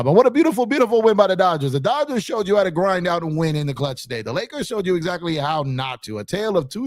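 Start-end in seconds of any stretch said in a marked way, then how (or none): 0.0-3.2 upbeat lift. Real, not acted. Turns from loss to Dodgers win.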